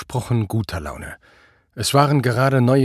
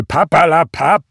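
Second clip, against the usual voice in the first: loud, higher, brushing it aside; dismissive call; 6 semitones higher, 8 dB louder than usual. A narrator's reading. Drunken boss interrupts his officer's refusal, calling out dismissively. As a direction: cut in loudly to dismiss the excuse. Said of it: "rief Prall".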